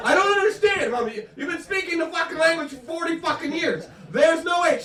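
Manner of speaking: high, loud outburst at mother; exasperated. Retold or imitated. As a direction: protest at full voice, utterly fed up. Acted exasperated son arguing with mother.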